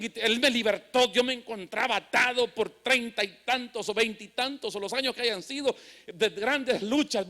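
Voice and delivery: driving, rhythmic preacher cadence; fervent testimony preaching. Real, not acted. Preacher testifying how God freed him, fervent. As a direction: pile up each failed search, building to a forceful close.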